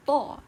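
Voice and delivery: "ta" with a heavy, emphatic T; a forceful "ta", unlike an English T. Shown as a demonstration. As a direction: hit "ta" loud with a dark Arabic T. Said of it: "an emphatic T".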